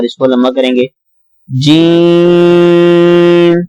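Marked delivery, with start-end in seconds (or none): 1.6-3.5 جیم drawn out very slowly over five counts; long jeem. Shown as a demonstration. Teacher models the long vowel in جیم for students. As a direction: stretch جیم long and steady. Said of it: "پانچ حرکات کے برابر کھینچیں".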